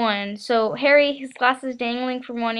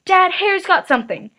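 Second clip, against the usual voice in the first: higher, louder tattling; 8 semitones higher, 7 dB louder than usual. A narrator's reading. Son telling on his cousin to his dad, loud and eager. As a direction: tell on him eagerly.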